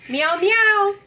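high, sing-song meow; imitated cat meow. Trying the toy cat's response, imitated meow. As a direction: meow up high to prompt a pet.

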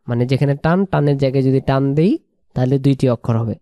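normal talking voice

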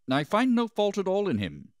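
high, loud declaration; public proclamation. A narrator's reading. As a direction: call the verdict out to a crowd. Pilate addresses the crowd.

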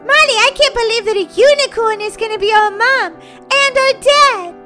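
put-on high, loud child voice; excited little sister. Little sister to Molly about parents, overjoyed. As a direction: squeal it out in delight.

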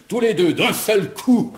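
high, laughing gloat. Acted. Commissaire gloating at the vicomtesse, laughing. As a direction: crow over them and laugh.

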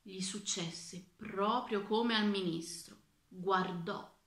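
usual speaking voice

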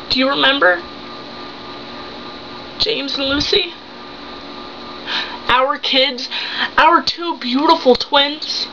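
wounded wife confronting her husband, with halting pauses; acted heartbreak, pushing for answers; betrayed wife, hurt. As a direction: plead and accuse as a deceived wife. Acts a monologue as betrayed wife.

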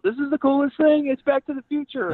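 put-on high, loud, thrilled kid voice; kid freaking out. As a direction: gush like a boy who just saw his dream car. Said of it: "he's, like, freaking out".

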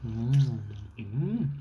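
speaking around hanging noodles; chewing while murmuring; mouth full of pasta. Real, not acted. Seen 1: Noodles in mouth from 2.0 to 7.7; talking without food at 0.3 and 8.9.

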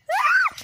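sudden high startled cry; startled shriek. Real, not acted. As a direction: yelp in surprise. Thunder in the sky.

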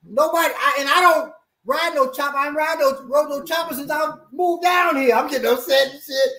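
high, exasperated protest; mock outraged whine; high flustered self-quote. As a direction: get worked up and squeaky. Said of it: "I said".

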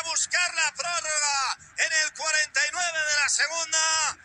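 loud radio goal recap; shouted goal commentary. Real, not acted. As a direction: announce the scorer and score.

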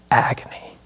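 whispered. Acted. Sermon climax on suffering.

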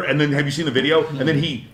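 ordinary delivery

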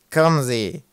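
loud, irritated growl; testy guide's growl. A narrator's reading. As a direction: growl it impatiently. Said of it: "knurrte Ratz gereizt".

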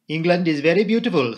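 put-on French-accented admiration; Poirot. A narrator's reading. Narrator voices Poirot admiring view.

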